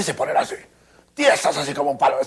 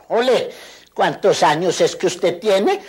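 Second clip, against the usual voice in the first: gruff doctor; 6 semitones higher, 4 dB louder, slower than usual. Retold or imitated. Imita al doctor López.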